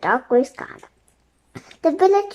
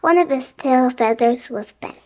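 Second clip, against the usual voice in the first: plain reading voice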